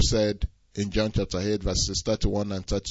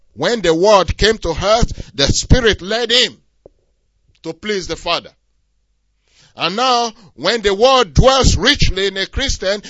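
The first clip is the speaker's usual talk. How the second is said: loud, higher, driving sermon voice; full-force preaching; 11 semitones higher, 9 dB louder than usual. Acted. Pastor exhorting the congregation, loud preaching. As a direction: preach it out with fire.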